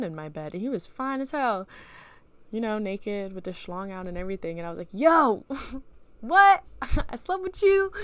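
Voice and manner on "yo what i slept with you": high, loud yelps broken by laughter; shocked disbelief. Acted. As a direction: exclaim it in laughing, hammed-up shock. Dream: waking beside a naked stranger.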